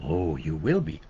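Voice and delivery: lightly voiced wise old Yoda; a hint of Yoda's warning; Yoda impression. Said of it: "And Yoda says".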